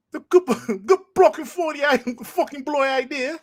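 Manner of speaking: high, tongue-tangled mock British; fake British accent. Host fumbling the bloody good idea line, fake British accent. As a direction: stumble through a squeaky put-on British accent. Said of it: "you just heard my fake British accent".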